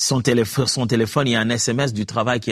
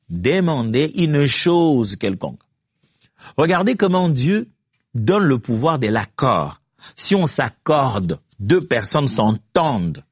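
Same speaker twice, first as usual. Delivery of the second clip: slow emphatic; slower than usual. Real, not acted.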